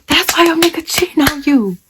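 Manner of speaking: high, put-on mocking voice; rival's taunt. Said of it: "and she was like".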